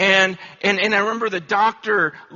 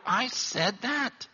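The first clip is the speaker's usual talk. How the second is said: slow, hushed, amazed at himself; a quiet did-I-really-say-that; slow hushed wonder; stunned disbelief; 6 semitones higher, 6 dB quieter, slower than usual. Retold or imitated. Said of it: "where I go".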